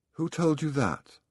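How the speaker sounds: put-on mildly startled boy's question. A narrator's reading.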